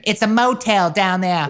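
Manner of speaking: put-on high, slow old Boston mother calling; nagging old mom voice, drawn out; old lady Boston voice. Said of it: "poor Boston accents".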